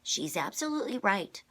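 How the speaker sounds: sharp edge. A narrator's reading. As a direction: cut in with an edge in the voice. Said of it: "with a sharp edge in her voice".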